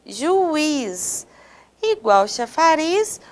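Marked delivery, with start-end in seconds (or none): 0.1-1.3 JUIZ drawn out, ju-IZ with a held IZ; stressed final syllable. Shown as a demonstration. Teacher quizzing students on stress, JUIZ with long stressed IZ. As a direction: stretch JUIZ into two beats, lingering on IZ. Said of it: "Qual é a sílaba mais forte?".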